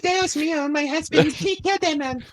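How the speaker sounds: very high hammed-up Spanish-wife voice; mock Spanish accent. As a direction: squeal it in a fake Spanish accent. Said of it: "Alec Baldwin's wife in the background is like".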